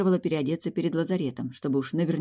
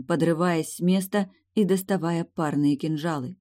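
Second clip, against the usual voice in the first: ordinary delivery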